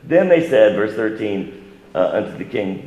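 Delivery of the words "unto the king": normal talking voice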